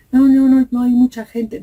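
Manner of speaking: high, loud panicked no no no; mock child's loud denial; frantic child protest. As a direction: blurt it out like a scared little girl refusing. Child refusing mother's push.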